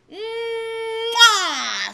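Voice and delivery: big high smacking kiss; loud smacking kiss. A narrator's reading. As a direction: pucker and smack loudly. Kiss sound.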